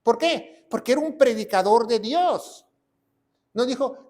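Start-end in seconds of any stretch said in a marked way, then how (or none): none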